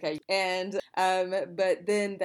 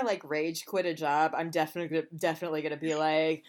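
usual speaking voice

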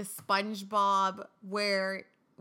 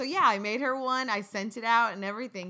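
usual speaking voice

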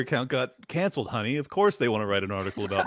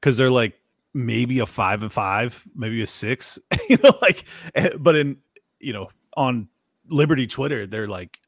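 ordinary delivery

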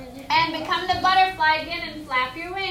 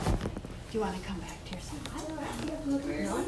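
soft whispered coaxing; 4 semitones lower, 13 dB quieter, faster than usual. Real, not acted. Teacher quietly coaxes a child back to the circle, whispering.

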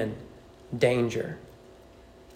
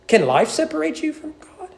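soaring question; 12 semitones higher than usual. Acted. Preacher repeats question, higher.

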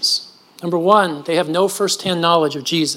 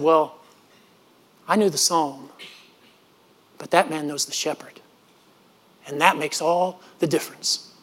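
quiet humble reply; 3 semitones lower, 7 dB quieter than usual. Retold or imitated. Orator's humble answer.

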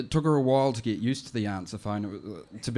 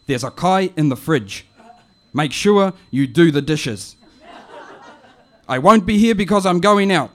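louder, bossy auntie message; louder auntie voice giving instructions; auntie on the phone; 8 semitones higher, 14 dB louder than usual. Retold or imitated. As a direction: auntie laying down orders over the phone. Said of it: "it goes like this".

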